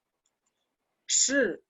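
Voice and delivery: 是 held long and slow; drawn-out model word. Shown as a demonstration. Teacher modeling the word 是 for students, drawn out. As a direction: stretch out 是 for the class. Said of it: "que es S H I".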